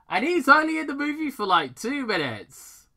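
loud, high-pitched exasperation; exasperated rant. Real, not acted. Fan griping that a famous actor got two minutes, exasperated. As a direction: complain emphatically about a real letdown.

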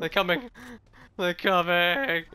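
alarmed. Real, not acted. Enemies approaching.

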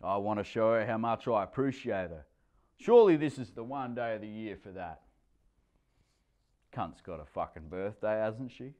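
plain reading voice

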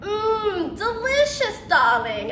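a lightly played grand lady savoring food; hammed-up fancy "darling", pitched high; posh little lady. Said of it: "I tell my sister".

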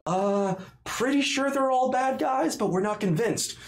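high-pitched, unsure soldiers; wavering soldiers. Acted. Soldiers going back and forth over targets, high and unsure. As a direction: voice puzzled guards weighing one suspect against another. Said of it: "they kinda go back and forth".

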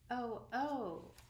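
breathless delighted oh, oh; a mild gasp of rapture; rapturous. A narrator's reading. Wendy thrilled at learning to fly, mildly rapturous. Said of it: "she exclaimed rapturously".

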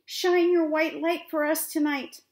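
loud, high wind calling out; big, carrying storybook cry; roaring call. A narrator's reading. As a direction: roar it out across the mountains. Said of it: "whispering and then roaring".

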